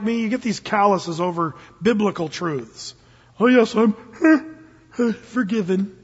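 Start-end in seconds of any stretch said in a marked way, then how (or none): 3.4-6.0 offhand, louder, a laugh slipped in; lackadaisical mock. Preacher mocking jaded old-timer Christians, offhand and laughing. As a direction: shrug it off like it's old news. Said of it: "this lackadaisical attitude".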